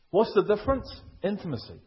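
usual speaking voice

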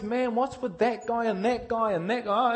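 a friend wondering aloud; puzzled mates. Retold or imitated. Friend questions the preacher about Christians, lightly puzzled. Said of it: "I have my mates".

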